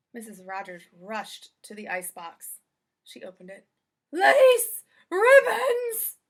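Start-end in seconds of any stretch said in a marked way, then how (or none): 4.1-6.2 very high, loud shocked cries; shocked exclamations. Acted. Missus Rogers opening the icebox, loud high shocked cries. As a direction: blurt each word out in disbelief.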